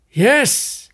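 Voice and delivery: shouted. Acted. Brinsley whirls round and shouts yes at Neil. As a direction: bark yes at him. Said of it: "he shouted".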